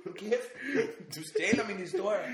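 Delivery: high, laughing mock complaint; cackle, then tease him slowly; laughing protest. [name] laughing at being corrected.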